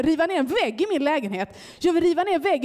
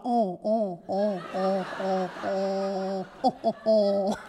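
low, soft drawn-out åh moans; hammed-up lovemaking sighs, lower and softer; playful imitation of moaning in bed; mock French-style moans; 8 semitones lower, 5 dB quieter, much slower than usual. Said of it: "hör jag mig själv låta bara".

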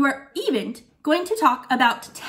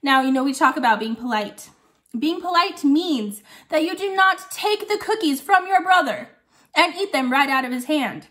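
normal talking voice

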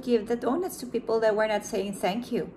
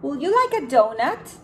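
bright, louder offering voice; Panda offering; 4 semitones higher, 6 dB louder than usual. Acted. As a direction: offer the donut cheerfully, rising. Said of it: "said Panda".

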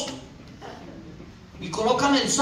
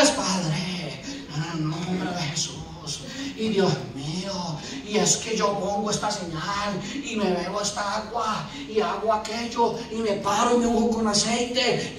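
low, soft, slow praying; hushed, drawn-out mock devotion; mock pious prayer; 5 semitones lower, 6 dB quieter than usual. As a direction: drone it like an over-pious ritualist. Mocks passive pious praying man.

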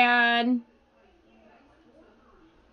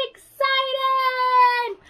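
much higher, exclaimed; excited; 12 semitones higher than usual. Shown as a demonstration. Teacher showing the class an excited voice, high and bright. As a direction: burst out with the word. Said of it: "How do you show you're excited?".

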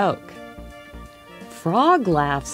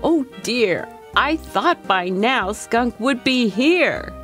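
higher-pitched fretting little bunny; worried bunny; 6 semitones higher than usual. A narrator's reading. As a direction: fret aloud that a friend is late. Bunny worries Skunk hasn't come.

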